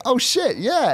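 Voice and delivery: high-pitched starstruck exclamation; starstruck, voice leaping high; retold thrilled reaction. Thrilled at being recognised by musician.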